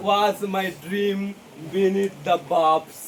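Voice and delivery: slow, lingering verse reading; slow poem recitation. Shown as a demonstration. Teacher reading the poem to students, slow recitation. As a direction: savour each word of the line. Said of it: "কবি বলছে".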